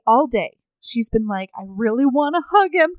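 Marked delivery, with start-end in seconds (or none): 1.6-2.9 higher, building eager yearning; mock pent-up longing, rising and louder; gushing longing. She aches to hug her husband, gushing.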